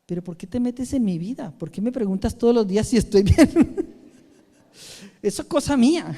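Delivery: half-laughing imitation of an irritated friend; mock annoyed friend. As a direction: quote her irritation with a laugh. Retold annoyed Spaniard.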